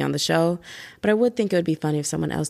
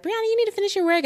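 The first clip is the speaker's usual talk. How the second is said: much higher, fast mock teacher nag; mock scolding teacher; much faster than usual. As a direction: imitate the teacher, high and rushed. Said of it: "they'll yell at me and be like".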